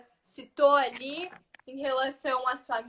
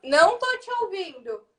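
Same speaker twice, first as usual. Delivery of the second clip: raised, high calling voice; to someone hard of hearing; 6 semitones higher, 6 dB louder than usual. Real, not acted. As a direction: speak up over a bad line. Bad call, host can't hear.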